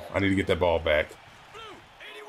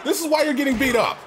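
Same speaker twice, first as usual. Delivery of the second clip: furious at his team's play; angry yell; 12 semitones higher than usual. Real, not acted. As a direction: rage at the screen. Angry rant at the game.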